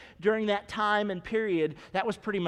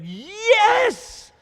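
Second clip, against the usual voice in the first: high, loud, jubilant shouting; overjoyed father-to-be shouting thanks to God; ecstatic shout; 9 semitones higher, 8 dB louder than usual. Retold or imitated. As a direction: shout for joy at answered prayer. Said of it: "his response would have been".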